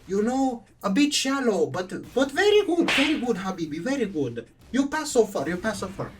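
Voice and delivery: imitated Middle Eastern teacher, high and approving; hammed-up Middle Eastern teacher giving approval; Middle Eastern accent. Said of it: "very thick Middle Eastern, like, accent".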